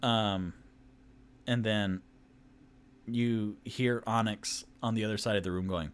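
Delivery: ordinary delivery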